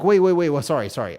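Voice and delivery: a loud, panicky sorry-sorry; flustered apology. Retold or imitated. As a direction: hurriedly back off and apologize.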